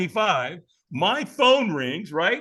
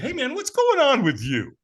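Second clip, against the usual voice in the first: buddy's greeting; 10 semitones higher, 4 dB louder than usual. Retold or imitated. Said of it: "he said".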